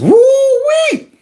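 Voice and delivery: long, high woo-wee; excited whoop. Real, not acted. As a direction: whoop as if something landed hard. Reacting to the speech in a clip.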